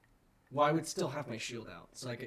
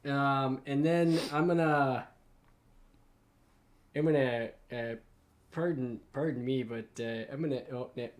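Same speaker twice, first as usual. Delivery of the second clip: normal talking voice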